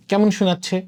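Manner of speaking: ordinary delivery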